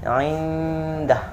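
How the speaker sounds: indah with a long hummed n; correct nasal hold. Shown as a demonstration. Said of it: "yang betul". (indah dengan dengung dua harokat)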